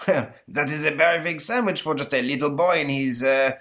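put-on chortling French-accented emperor; mocking Napoleon. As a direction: scoff with laughter in a stagey French accent.

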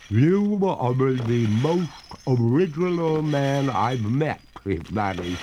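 high, slow, eerie lady ghost; a drawn-out ghostly lady. A narrator's reading. Ghost answering the young master, high and eerie.

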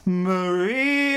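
high, drawn-out call of a name. Pretend. Host hailing caller Maria, calling out. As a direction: sing out the name as if hailing someone.

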